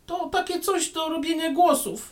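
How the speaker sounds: a little gnome piping up; thin voice. Acted. Small gnome speaks.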